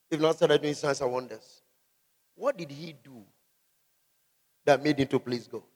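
ordinary talk

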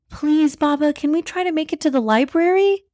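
high, eager pleading; high-pitched excited kid voice; excited child. Acted. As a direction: beg dad like a hopeful little kid. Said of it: "Talia felt excited too now".

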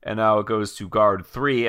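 normal talking voice

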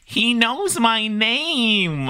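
high, very slow hammed-up movie line; drawn-out villain line. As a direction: stretch every word, mock dramatic.

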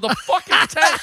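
loud, high, laughing outburst; exasperated. Retold or imitated. Yelling at son-in-law about the tank, exasperated. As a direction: retell a fed-up shout through laughter. Retold argument, exasperated answer.

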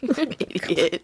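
slow, amused, through laughter; laughing insult. Real, not acted. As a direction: tease while laughing. Laughing at the joke.

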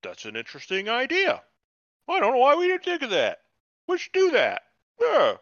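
high, wide-eyed, eager agreement; mock naive smith. Mocking the smith praising the stranger's obvious idea, high and eager. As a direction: gush over the idea like a simpleton. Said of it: "looks at him and says".

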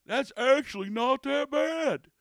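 high, loud, drawn-out mock verdict; mocking customer impression. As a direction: parody the insultingly surprised drinker. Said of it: "It's kind of insulting".

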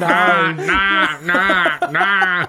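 put-on loud, repetitive child's whine; whining child. Co-host mocking the annoying sound, whining child. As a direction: mimic a child denied ice cream. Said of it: "Like a child who didn't get ice cream".

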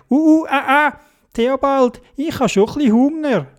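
high squeaky voice with fussy sounds; high Dodo voice. Acted. Dodo telling Theobald he is hungry. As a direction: whine it high like a small bird. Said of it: "der Dodo gesagt".

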